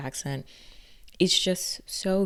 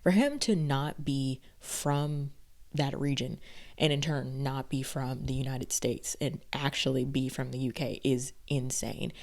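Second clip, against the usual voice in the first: usual speaking voice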